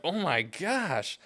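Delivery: high, slow, stunned gasp of disbelief; shocked. Retold or imitated. Reacting to the neighbor's outburst, slow high shocked disbelief.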